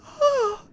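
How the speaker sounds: high swooning ah; mock swoon sigh. Mimicking the office women swooning over him. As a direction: swoon like a smitten woman.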